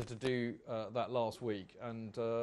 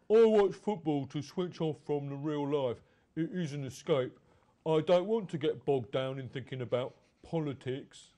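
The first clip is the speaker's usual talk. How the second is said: Chelsea fan voice; 2 semitones higher, 5 dB louder than usual. Retold or imitated. Host reading a fan's excuse in a lightly mocking fan voice. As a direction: read the quote as a lightly mocked Chelsea fan. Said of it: "shall I do it in a Chelsea fan?".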